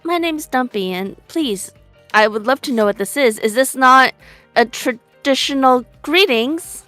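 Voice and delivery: usual speaking voice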